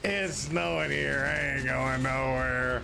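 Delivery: a hint of a hammed-up slow drawl; slow mock drawl. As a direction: stretch it out slowly, lightly exaggerated. Repeats line after laughing, drawn out.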